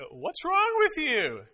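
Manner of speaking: high, slow, puzzled plea; bewildered dad asking. Retold or imitated. Father asking his sobbing daughter what's wrong. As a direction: coax it out of her, baffled.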